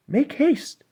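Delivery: desperate urging. Acted. Sisters urge mermaid to kill.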